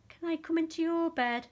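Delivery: high, loud small-mouse plea; squeaky little mouse asking; Little Mouse's voice. A narrator's reading. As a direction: pipe it up like a tiny pleading mouse. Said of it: "said Little Mouse".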